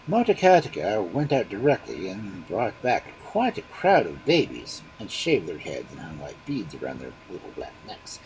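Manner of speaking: usual speaking voice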